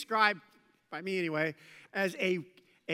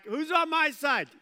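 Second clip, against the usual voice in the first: loud and higher call; rallying call; 7 semitones higher, 5 dB louder than usual. Acted. Jehu challenges the servants, loud higher call. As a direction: bellow the challenge up to the window.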